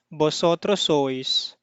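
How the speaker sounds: ordinary talk, nothing marked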